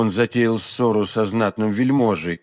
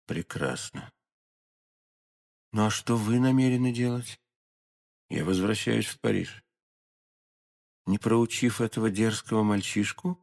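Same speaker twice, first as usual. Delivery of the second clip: much softer, lower private exchange; hushed dialogue; 4 semitones lower, 9 dB quieter than usual. A narrator's reading. Lady asking the stranger his plans, hushed. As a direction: keep it quiet and contained. Private talk before departure.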